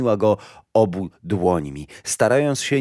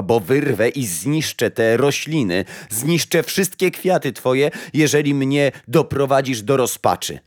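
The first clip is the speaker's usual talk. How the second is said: raised, higher-pitched threatening voice; desperate threat; 6 semitones higher, 4 dB louder than usual. A narrator's reading. Mother threatens Death's flowers, desperate and rising. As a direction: build in pitch toward desperation.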